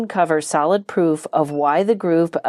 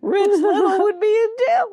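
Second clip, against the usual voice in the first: much higher, laughing joke; joking laugh. Real, not acted. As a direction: squeak out the joke while laughing. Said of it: "No, I'm kidding".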